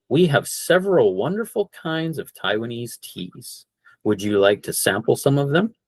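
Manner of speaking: normal quick pace, no pauses; full speed. Shown as a demonstration. Teacher to class at full speed. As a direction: say it at real speed. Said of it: "So up to full speed".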